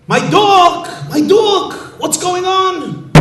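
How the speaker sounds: high, loud joyful cries; a ruler thrilled to see his dog; overjoyed overlord. Acted. Overlord reunited with his dog, high joyful cries.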